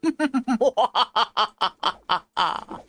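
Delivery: hammed-up villain laugh; gleeful mock evil cackle. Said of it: "I need to do an evil laugh".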